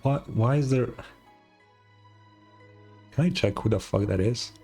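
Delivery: ordinary talk, nothing marked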